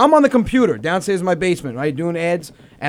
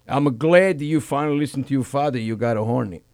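put-on low, quiet accented dad; imitated father; 7 semitones lower, 7 dB quieter than usual. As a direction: confide it softly, low, as the proud father. Voicing father at the barbecue.

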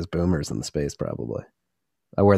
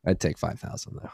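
whispered aside; 8 dB quieter than usual. Real, not acted. Quiet confession aside.

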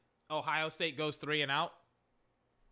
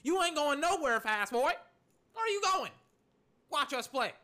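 much higher, cocky taunting voice of the rival team; mock trash talk; 9 semitones higher than usual. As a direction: sneer at the player like a taunting opponent. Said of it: "They're saying".

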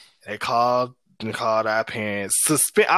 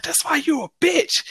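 mocking girl's taunt. Girl yelling insult after chase.

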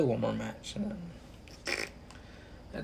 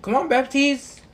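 frustrated yell; 11 semitones higher, 6 dB louder than usual. Real, not acted. Yelling at teammate.

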